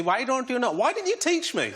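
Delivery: high-pitched imitation of a reproachful mum; scolding mum. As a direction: mimic mum's Sri Lankan scolding, pitched high. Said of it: "and she goes to me".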